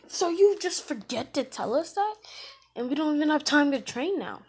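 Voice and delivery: high, loud mock outrage; indignant complaint. Team indignant at late exam news.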